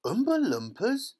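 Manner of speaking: high, loud incredulous question; astonished chorus. A narrator's reading. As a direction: exclaim "Oompa Loompas" in disbelief, rising sharply. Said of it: "everyone said at once".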